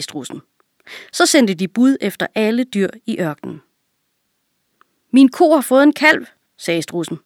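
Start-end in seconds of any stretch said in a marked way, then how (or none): 5.1-6.3 higher, louder assertion; insistent claim. A narrator's reading. Ostrich stating its case to all the animals, insistent. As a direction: state your case firmly, raised pitch. Ostrich quarrels before the council.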